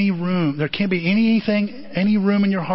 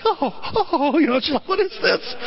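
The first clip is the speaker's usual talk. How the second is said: high, startled squeal; Mary flees a stranger chasing her, mock fright; mock frightened Mary; 7 semitones higher than usual.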